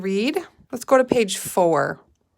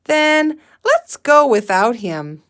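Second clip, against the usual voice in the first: higher, louder and slow; brisk decision; 5 semitones higher, 7 dB louder, slower than usual. A narrator's reading. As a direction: announce it and move on. Katie giving up.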